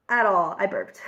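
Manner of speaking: ordinary talk, nothing marked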